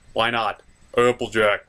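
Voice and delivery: ordinary talk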